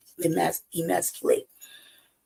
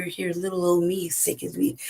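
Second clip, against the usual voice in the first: usual speaking voice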